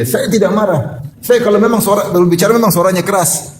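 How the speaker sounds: high, defensive protest; mock defensive man; defensive denial. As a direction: insist you're not angry. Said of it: "Ada orang bicara sangat keras".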